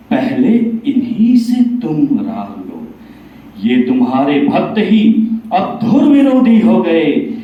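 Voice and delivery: declamatory poem voice, swelling on key phrases and falling at line ends; Kaliyug in recited verse. Acted. Kaliyug challenging Bhagwan in a poem, ringing declamation. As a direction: recite as a proud, defiant challenger, punching the key words. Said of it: "तो कलयुग कहते हैं".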